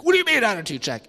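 defensive retort. Retold or imitated. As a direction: bristle at being called out.

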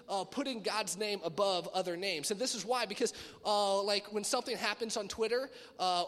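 normal talking voice

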